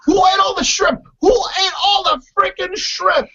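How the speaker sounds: hammed-up shouting; mock angry yell. Mimicking Andrew demanding who ate the shrimp, high yell.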